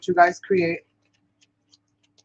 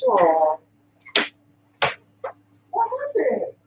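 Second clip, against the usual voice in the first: soft high coo to a pet; 11 semitones higher, 4 dB quieter, much slower than usual. Real, not acted. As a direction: coo gently at the dog. Talking sweetly to her dog.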